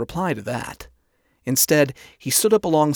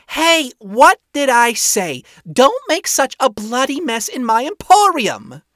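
high, loud scolding shop owner; hollering; 11 semitones higher, 7 dB louder than usual. A narrator's reading. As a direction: holler a scolding down to the floor below. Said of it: "A hollering came from downstairs".

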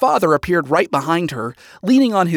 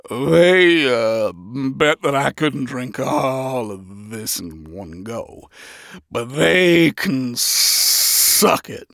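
very slow, woozy slurring; drunken slur; 3 semitones lower, much slower than usual. A narrator's reading. Cat sinner after a barrel of liquor, slurring drunk. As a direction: slur it as if about to pass out. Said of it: "The feline sinner slurred in intoxication".

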